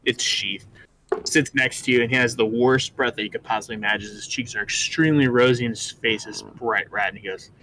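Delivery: ordinary talk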